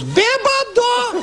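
stretched-out squeaky bêbado, very high; very high, slow snake taunt. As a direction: drag out the teasing call slowly, way up high. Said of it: "The voice goes".

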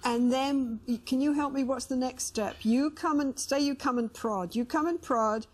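ordinary delivery

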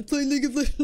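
high, loud mock pushy friend; mocking League fans. Mocking friends pushing League, high and loud. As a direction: tease the pushy voice. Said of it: "mock of friends again".

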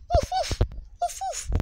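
high yappy little-dog woofs; puppy woofs. Retold or imitated. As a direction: bark like a tiny excited puppy. Finds a wolf, imitates it.

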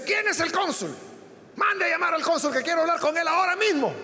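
high, demanding retold outburst; speaking loudly, annoyed. Angry brother demanding the consul at the window, high and impatient. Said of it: "le empieza a hablar fuerte".

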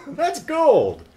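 high laugh then gleeful cry; laugh, then crow with delight. Real, not acted. Delighted at gory scene.

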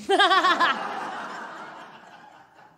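loud burst of laughter; laughing fit. Real, not acted. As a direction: crack up at your own joke. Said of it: "Oh, le fou rire".

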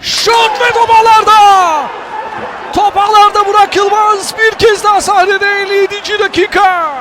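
loud excited goal call; screaming football commentator. Real, not acted. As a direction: shout it as the ball hits the net.

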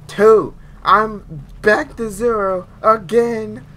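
loud then slow dragging complaint; indignant outburst. Real, not acted. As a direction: exclaim in outrage. Upset about lost bullets.

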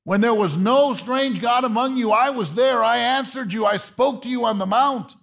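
high, rising, fervent preacher's call; impassioned pulpit preaching; preaching at full force. Acted. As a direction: lift it and drive it home to the congregation.

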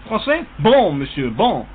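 put-on showy French accent. Prank caller playing at being French, in a hammed-up French accent. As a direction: play the hammy Frenchman. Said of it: "What about French?".

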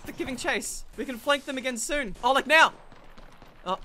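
normal talking voice

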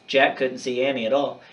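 plain reading voice

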